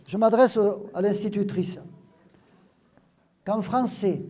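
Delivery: normal talking voice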